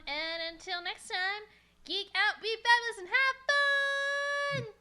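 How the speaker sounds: climbing, drawn-out catchphrase; rousing sign-off. Pretend. Sung-out catchphrase closing show.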